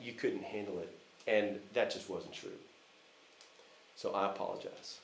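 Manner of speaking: put-on soft, gentle sorry to a child under the covers; tender apology. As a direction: quietly make amends, heart open. Father apologizing to crying daughter.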